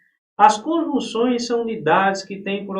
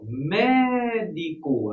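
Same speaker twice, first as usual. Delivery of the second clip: "médico" with MÉ held very long; slow "MÉÉÉ-dico", teacher's demo; stress on antepenultimate syllable; much slower than usual. Shown as a demonstration. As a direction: stretch the first syllable of "médico". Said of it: "a sílaba tônica aí é a antepenúltima".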